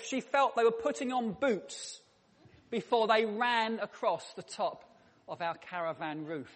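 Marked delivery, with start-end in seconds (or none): none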